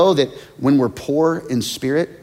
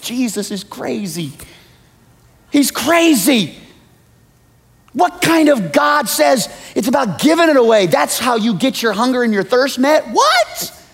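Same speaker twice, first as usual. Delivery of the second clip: mock outrage; 10 semitones higher than usual. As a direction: ham up the baffled disbelief.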